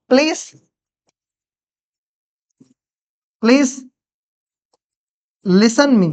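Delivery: long pauses after "please"; word-by-word dictation. Shown as a demonstration. Teacher translating a polite request for students, word-by-word dictation. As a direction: spell out the sentence piece by piece.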